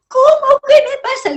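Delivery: retold mother screaming in panic; mother's shout. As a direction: shriek it back in disbelief. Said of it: "gritaba mi mamá".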